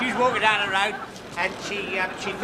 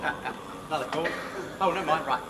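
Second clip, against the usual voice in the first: ordinary talk, nothing marked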